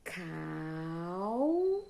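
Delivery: "Cal" drawn out long, ending on a rise; teacher holding "Cal" for the class; isolated syllable. Shown as a demonstration. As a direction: stretch out "Cal" as one slow rising syllable. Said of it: "Vamos lá?".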